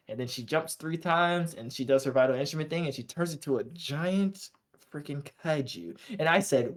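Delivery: ordinary delivery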